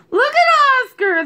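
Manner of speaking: high, loud jeer; mocking cry. A narrator's reading. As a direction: call the others over to laugh at him. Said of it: "they cried".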